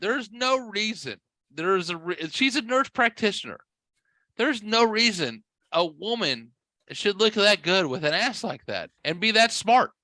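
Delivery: high, insistent mock outrage; incredulous rant. Retold mock rant resumed.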